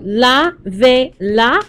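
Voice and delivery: la, ve, la as three separate syllables. Shown as a demonstration. Teacher counting syllables for students, la, ve, la one at a time. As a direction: break it up as "la", "ve", "la", pausing after each. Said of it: "count back three syllables".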